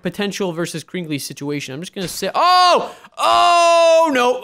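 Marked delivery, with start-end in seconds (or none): 2.3-4.4 high, loud shocked oh no; startled cry. Real, not acted. Gamer startled by a sudden attack, loud cry. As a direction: yelp it out in alarm.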